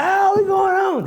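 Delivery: high, slow mocking voice; mock eager question. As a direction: ham up the naive excitement.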